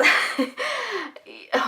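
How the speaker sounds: a burst of real laughter; laughing. Real, not acted. Laughing at a viewer's question about trends.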